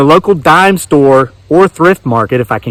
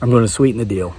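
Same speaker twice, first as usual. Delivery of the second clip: softer, lower hushed sales line; hushed pitch; 6 semitones lower, 8 dB quieter, much faster than usual. Acted. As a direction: lean in and say it softly. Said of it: "Sit, sit, sit".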